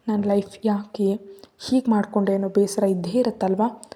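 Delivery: usual speaking voice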